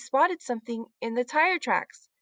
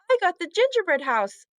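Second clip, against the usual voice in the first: excited announce; 7 semitones higher, 4 dB louder than usual. A narrator's reading. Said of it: "said Heidi".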